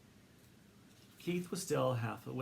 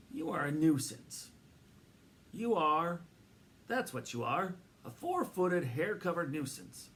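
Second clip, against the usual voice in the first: scolding; 5 semitones higher than usual. A narrator's reading. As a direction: scold the dog. Annoyed owner scolds dog.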